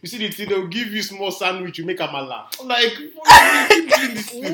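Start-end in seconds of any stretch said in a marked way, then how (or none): 2.6-4.5 high, slow, laughing. Real, not acted. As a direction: ask it while cracking up. Laughing mock question.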